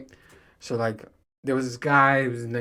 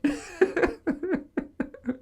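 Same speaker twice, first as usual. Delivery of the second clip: real high laughter; laughing at his own story; 3 dB quieter than usual. Real, not acted. Laugh alone.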